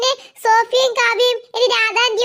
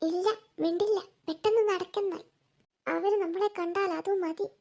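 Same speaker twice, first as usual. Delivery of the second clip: much softer, hushed voices; hushed sneaking; 9 dB quieter than usual. Acted. Sisters sneaking up to spy, speaking hushed. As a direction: keep it down so they won't hear.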